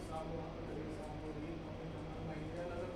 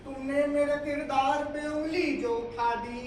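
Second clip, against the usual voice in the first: raised, measured verse delivery; mushaira poetry recitation; 4 semitones higher, 9 dB louder, slower than usual. Acted. Poet reciting couplets to a mushaira audience, declaimed verse. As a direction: declaim each line to the crowd.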